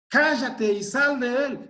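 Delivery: commanding rebuke. Acted. Preacher reenacts the command.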